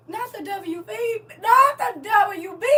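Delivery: high, laughing cry of protest; laughing squeal. Real, not acted. Singer laughing at the W B frog joke, high squeal. Reacting to being called WB frog.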